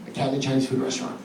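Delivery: very soft, low, fast restatement; hushed title repeat. Real, not acted. Comic restates the cat bit before performing it, hushed. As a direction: toss it off quickly, low and hushed.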